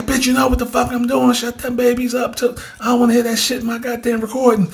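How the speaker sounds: high-pitched, agitated imitation of a man scolding; worked-up friend telling off his girlfriend; mock angry rant. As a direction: rant it fast and high, as acted-out quarrel. Acts out friend snapping at girlfriend.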